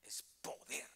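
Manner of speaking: hushed whisper quoting the preacher; whispered quote. Retold or imitated. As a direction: breathe the words out as a hushed secret.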